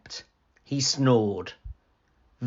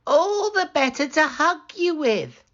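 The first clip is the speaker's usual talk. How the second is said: much higher sly granny; wolf as grandmother; 4 dB louder than usual. Acted. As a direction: answer sweetly with menace.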